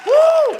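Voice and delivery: very high, short "woo"; high whoop. Acted. Comedian reacting to Disney job, high whoop. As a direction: let out a falsetto whoop.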